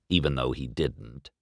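ordinary delivery

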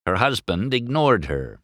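plain reading voice

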